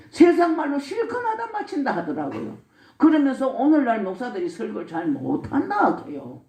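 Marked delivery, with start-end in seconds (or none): 0.1-2.0 Gyeongsang dialect. Retold or imitated. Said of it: "경상도 말로 하시더라니까".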